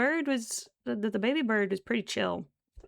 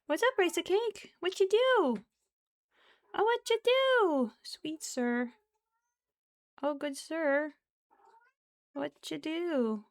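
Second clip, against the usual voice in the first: high cooing voice to a pet; 7 semitones higher, slower than usual. Real, not acted. Cooing high to her pet rice cake.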